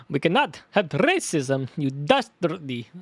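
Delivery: slow, cartoony Transylvanian accent. Shown as a demonstration. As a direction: stretch out the insult in a Transylvanian accent. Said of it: "in that Transylvanian voice".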